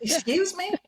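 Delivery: high rising excuse me, imitating her; a light imitation of a confused stewardess; mock puzzled flight attendant. Mimicking the flight attendant who didn't understand her, high puzzled ask. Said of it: "she was like".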